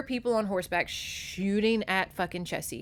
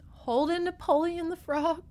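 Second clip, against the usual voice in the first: high rising glee on Napoleon; delighted; 9 semitones higher than usual. Real, not acted. Vlogger delighted by the frog Napoleon, high and gleeful. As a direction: savour the frog's name.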